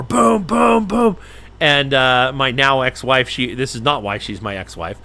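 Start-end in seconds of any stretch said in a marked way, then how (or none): 0.0-1.2 higher, punchy boom sounds; door pounding. Retold or imitated. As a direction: mimic kicking a door. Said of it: "I start kicking on the door".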